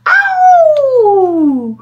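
very high, loud yelp of pain; scald-burned wolf yelp; piercing shriek. Acted. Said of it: "With a piercing shriek".

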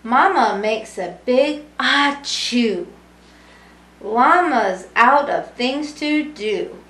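lively sing-song storybook reading; reading to children. A narrator's reading. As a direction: read it warmly to a room of kids. Reading picture book to children.

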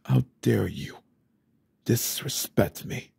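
usual speaking voice

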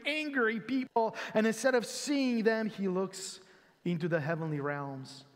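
ordinary talk

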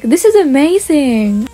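drawn-out show of delight; put-on amazement, stretched out; pretend excitement. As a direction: gush slowly to get everyone's attention. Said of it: "I need lots and lots of attention".

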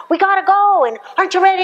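elevated hurried voice. Retold or imitated. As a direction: rush everyone out the door. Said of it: "this elevated voices".

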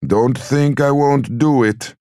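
high, slow, drawn-out threat; distraught mother. A narrator's reading. Mother threatening her son over supper, distraught.

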